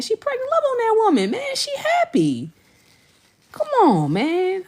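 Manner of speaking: very high, loud gushing praise; excited squeal. Real, not acted. Hyping up pregnant viewer Ash to the chat, high squeal. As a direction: squeal about her news to the chat.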